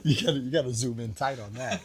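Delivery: talking through laughter; giggling while speaking; laughing. Real, not acted. Host laughing asking the camera to zoom in.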